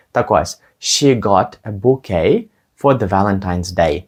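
ordinary talk

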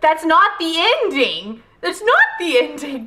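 imitating a loud, slow protest at the TV; half-hearted yell, slowly stretched out; mock yell. Retelling how she protested at her TV, mock yell. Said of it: "I yelled at my television".

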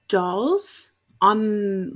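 ordinary talk, nothing marked

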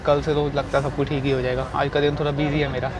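ordinary talk, nothing marked

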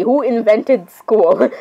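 drawn out, each word hammered slowly; slow rhetorical rant. Real, not acted. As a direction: demand it slowly, exasperated. Repeats the rhetorical question.

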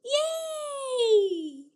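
normal talking voice

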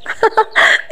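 soft, airy little laugh; short amused chuckle; light laugh. A narrator's reading. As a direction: laugh quietly to yourself. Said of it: "فضحكت".

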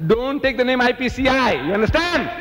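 high, forceful warning; indignant order. Acted. Speaker forbidding mention of his group, high indignant order. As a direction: lay down the order, pitched well up. Acting out his protest, emphatic take.

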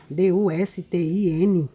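D U S T I N read slowly, one letter at a time; letters of D U S T I N given one by one, unhurried; spelling it out letter by letter. Shown as a demonstration. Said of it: "Tá escrito assim".

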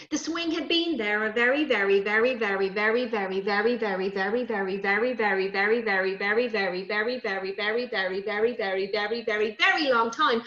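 "very" chanted over and over in an even, rolling run; comic repetition. A narrator's reading. Storyteller exaggerating the swing's age, "very" endlessly repeated. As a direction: keep "very" going steadily, then lift the last one.

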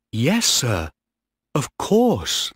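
put-on high, keen boy's reply; smiling, eager boy. A narrator's reading. Boy asked about becoming captain, smiling and eager. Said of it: "Peter Hayward smiled".

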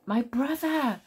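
high-pitched call from afar; crying out. A narrator's reading. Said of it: "Blue cried from the arch".

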